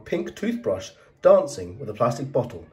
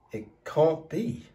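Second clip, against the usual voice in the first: frowning; 4 semitones higher, 4 dB quieter, slower than usual. Acted. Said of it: "her mother frowned".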